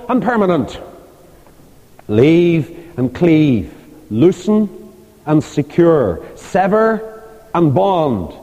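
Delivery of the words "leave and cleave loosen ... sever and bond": slow and deliberate. Shown as a demonstration. Preacher slowly setting out marriage principles. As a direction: leave and cleave spaced out, x slow. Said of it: "slow paired phrases for emphasis".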